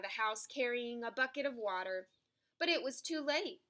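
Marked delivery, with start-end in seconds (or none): none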